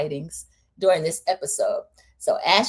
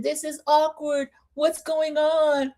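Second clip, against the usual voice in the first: higher, slow awkward question; awkward quote; 8 semitones higher, slower than usual. Retold or imitated. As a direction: ask it uneasily, drawn out. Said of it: "She said".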